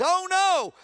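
frightened servant in a story; scared servant. Retold or imitated. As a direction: cry out in alarm. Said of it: "the servant goes".

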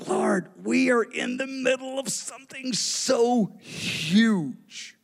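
slow, high, drawn-out wonder; retold awed prayer. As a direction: stretch it out in awe to God.

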